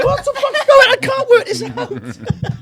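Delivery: high, slow baffled squeak; lightly mocking a baffled friend; confused sunburnt mate.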